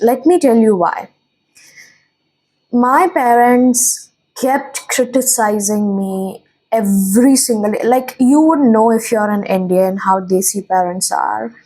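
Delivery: ordinary talk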